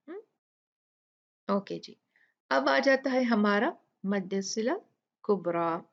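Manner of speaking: normal talking voice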